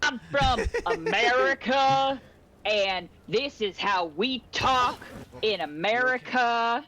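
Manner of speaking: slow, over-the-top mock American accent; exaggerated American accent. Player mimicking overseas friends' attempt, exaggerated American accent. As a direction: imitate foreigners overdoing an American accent. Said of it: "overseas who I love their American accent".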